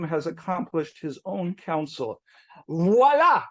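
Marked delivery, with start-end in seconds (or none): 2.7-3.4 louder, higher French flourish; proud, slow "Voilà, c'est écrit". Shown as a demonstration. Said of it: "please forgive my mediocre French accent".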